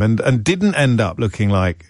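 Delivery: ordinary talk, nothing marked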